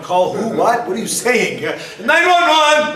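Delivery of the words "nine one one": high, loud hammed-up yell; exaggerated alarm cry; mock emergency shout. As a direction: holler for help in mock panic. Acting out an emergency call shout.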